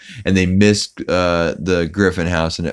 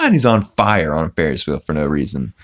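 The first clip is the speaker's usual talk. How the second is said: ordinary talk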